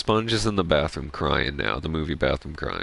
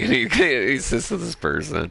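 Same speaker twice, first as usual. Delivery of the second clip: high-pitched, laughing through the words; giggly, pitched-up retelling; 10 semitones higher, 4 dB louder than usual. Real, not acted. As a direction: crack up while telling it. Cracks up recalling the scene.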